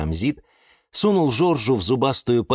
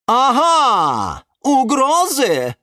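loud high-pitched shriek of triumph; explode, drawing the words out; high, loud, slow accusation; explosive outrage; 6 dB louder, much slower than usual. A narrator's reading. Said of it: "взорвалась Галатея".

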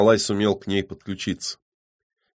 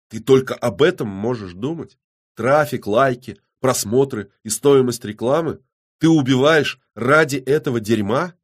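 raised, incredulous questioning; appalled outrage; 6 semitones higher, 6 dB louder than usual. A narrator's reading. Man cannot believe the killer's motive, appalled. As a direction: list each thing with indignant disbelief.

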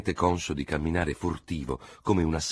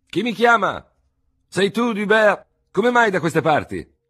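high, loud call from a window above; surprised old doctor shouting from the window; old army surgeon calling down; 10 dB louder than usual. Acted. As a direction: call down to the street, puzzled.

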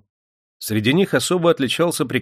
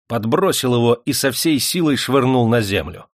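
ordinary delivery, nothing marked

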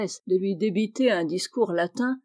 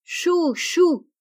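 high-pitched goose calls; two high cries, each falling; crying geese; 8 semitones higher, 4 dB louder than usual. A narrator's reading. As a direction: honk it out like a flock of geese. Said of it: "les oies ouvrirent leurs cent becs et crièrent".

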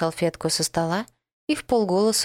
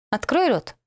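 commanding; 7 semitones higher than usual. Acted. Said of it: "скомандовала".

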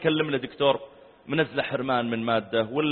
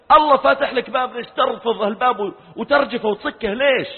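louder and higher, rising scolding; passionate rebuke; 9 semitones higher, 5 dB louder than usual. Retold or imitated. Sheikh rebukes his student for abandoning prayer, loud and impassioned. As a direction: pour out an impassioned reproach.

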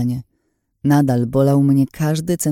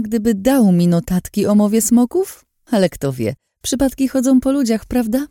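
much higher, sing-song taunting woman; mocking villainess; 8 semitones higher, 3 dB louder than usual. A narrator's reading. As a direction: tease him with false sweetness. Lin's voice held.

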